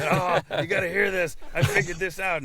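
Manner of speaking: high, eager burst of excitement; bubbling over with a new discovery; excited quote. Retold or imitated. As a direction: gush like you just cracked it. Excited texts voiced by friend.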